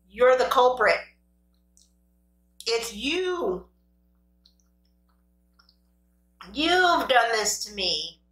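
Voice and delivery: playfully accusing, drawn-out final line; mock accusation. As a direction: point the blame with drawn-out, swooping emphasis. Mock-accusing the potatoes on the plate.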